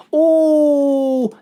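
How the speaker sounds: sudden high loud oh of discovery; light bulb moment, shout it; a big excited gasp of an idea. Real, not acted.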